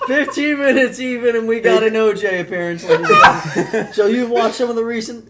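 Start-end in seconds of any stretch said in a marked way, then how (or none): 0.1-3.9 mock announcer. Host tells the listeners the news, laughing showman announcer. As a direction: present it to the crowd like a showman.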